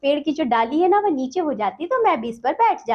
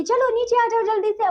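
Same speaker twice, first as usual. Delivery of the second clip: mother calling; 8 semitones higher than usual. Retold or imitated. Voicing mother calling child down.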